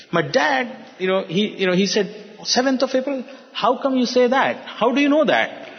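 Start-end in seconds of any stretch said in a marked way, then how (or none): 2.4-3.2 high-pitched, incredulous echo of SEVENTH OF APRIL; retold disbelieving question, pitched way up; imitated incredulous query. Dad disbelieves the prediction. 3.5-5.7 high-pitched disbelieving questions; retold disbelief, pitched up without shouting; imitated incredulous challenge. Dad challenges the uncle's prediction.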